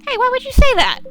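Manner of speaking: high, loud objection; indignant protest. Acted. Girl objects to what the dad said, high indignant protest. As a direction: squawk it, scandalized. Acted objection to the cop's admission.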